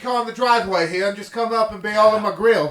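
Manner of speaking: fast, loud, rambling Australian bloke; loud put-on Australian accent. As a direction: rattle it off like a brash Australian neighbour. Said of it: "All right, that's pretty good".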